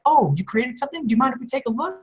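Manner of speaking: high, soft, sweetly asking; high-pitched mock politeness; mock corporate request. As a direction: imitate a polite corporate ask in a lifted voice. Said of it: "because they were like".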